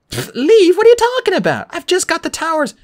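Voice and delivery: high-pitched, irritated protest; annoyed. A narrator's reading. Annabeth refuses to leave her game, annoyed. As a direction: scoff loudly, then argue back high. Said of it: "She looked up annoyed".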